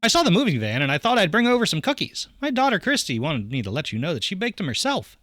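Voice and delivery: high-pitched, eager neighbor voice; friendly next-door neighbor. Acted. Neighbor explaining his daughter's cookies, in a high, friendly voice.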